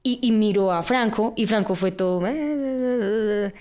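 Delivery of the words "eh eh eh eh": awkward stammer. Retold or imitated. Friend stammers when caught.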